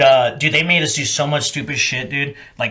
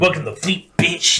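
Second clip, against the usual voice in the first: mock tough welcome; 3 semitones higher than usual. Hazers greeting a new marine, mock tough welcome. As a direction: hit the insult harder. Repeats the hazing welcome with bite.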